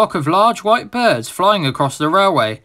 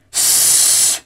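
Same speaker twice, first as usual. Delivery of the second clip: swan hiss. Acted. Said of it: "it said again".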